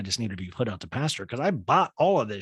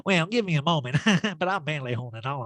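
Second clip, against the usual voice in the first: giggly, higher mock voice; hammed-up laughy impression; Erica; 6 semitones higher, faster than usual. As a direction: laugh between the phrases. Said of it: "laughy, giggly Erica".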